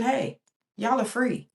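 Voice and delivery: ordinary talk, nothing marked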